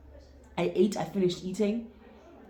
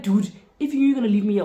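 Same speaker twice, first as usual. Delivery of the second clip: louder, indignant; outraged; 3 semitones higher, 8 dB louder than usual. Retold or imitated. Date says he'll leave her, she protests loudly. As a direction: throw his words back at him loudly.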